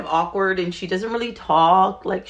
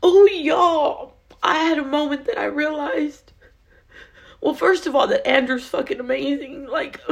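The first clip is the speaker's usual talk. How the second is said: high, emotional, tearful confession; choked-up gratitude in a raised pitch; overcome with emotion; 7 semitones higher than usual. Real, not acted. Speaker tells viewers her husband is amazing, overcome.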